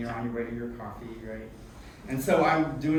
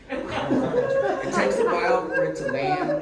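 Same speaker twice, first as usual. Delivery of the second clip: high and loud, through laughter; laughing; 8 dB louder than usual. Real, not acted. Bob to the audience about his joke, laughing. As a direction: crack up at your own joke.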